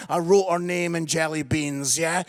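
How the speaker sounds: usual speaking voice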